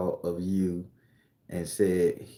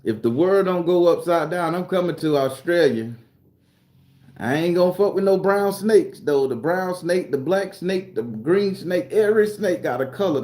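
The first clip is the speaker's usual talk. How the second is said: higher, louder, fired-up warning; excited rant; 7 semitones higher, 4 dB louder than usual. Real, not acted. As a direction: warn them with big energy. Excited rant about snakes.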